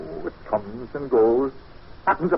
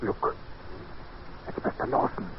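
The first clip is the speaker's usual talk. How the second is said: hushed whisper so Lawson won't hear; whispered warning; 4 semitones lower, 9 dB quieter than usual. Acted. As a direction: breathe it out urgently, no voice. Spotting Lawson from dark veranda.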